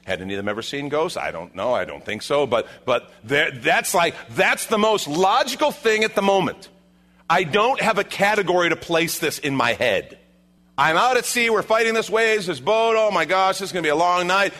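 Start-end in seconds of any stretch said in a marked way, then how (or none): 3.5-14.5 high, loud, overwhelmed outburst; agitated, raised-voice acting; bewildered disciple. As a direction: blurt it out mid-storm, out of your depth. Acts out bewildered disciples at sea.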